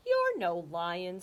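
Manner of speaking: slow words through laughter; laughing. Acted. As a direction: chuckle the words out. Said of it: "Ollie shook with laughter".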